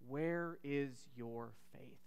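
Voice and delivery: slow, soft, low, gentle question. Retold or imitated. Jesus asks his frightened disciples, slow and soft. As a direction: ask it quietly, almost in sorrow. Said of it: "asks them".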